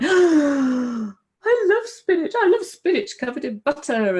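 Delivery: high, slow, stretched-out cry of dismay; mock dismay. Woman hearing forum warnings against spinach, mock dismay, high and drawn out. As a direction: wail it as if your favourite food were being taken away.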